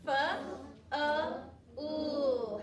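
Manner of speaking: "F, uh, oo" as separate sounds with gaps between; sounding out. Shown as a demonstration. Teacher leads the class through the sounds in full, "F, uh, oo" one by one. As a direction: give each of "F, uh, oo" its own beat. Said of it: "Let's do the sounds in full".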